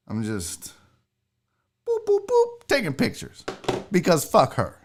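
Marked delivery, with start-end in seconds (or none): 1.9-2.7 high little boops; three light, high-pitched tapping noises; playful mimicked camera-button boops; imitated phone taps.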